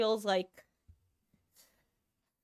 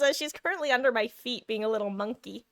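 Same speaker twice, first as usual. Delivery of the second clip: normal talking voice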